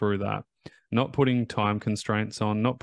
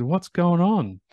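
high, louder, excited question to a beaming client; bright surprised question; 8 semitones higher, 5 dB louder, slower than usual. Retold or imitated. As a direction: call it out delighted and higher. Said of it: "And I said".

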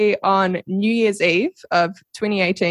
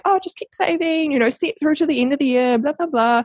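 a high, mild mock of a nagging mom; light higher-pitched impression of her mother; imitating mom; 7 semitones higher than usual. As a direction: brush it off the way mom would, a bit higher. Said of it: "my mom was just being like".

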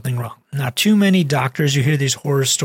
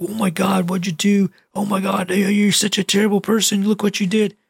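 higher-pitched, half-hearted yelling at someone; mock scolding; 4 semitones higher than usual. Imagined doctor berating whoever yanked out the cord, mock scolding. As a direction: put on an overwrought telling-off without raising the volume. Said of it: "Yell at that person?".

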